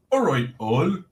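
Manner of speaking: a lightly put-on viewer's greeting; lightly voiced cheery catchphrase; Stickman TV impression. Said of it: "That's how he talks".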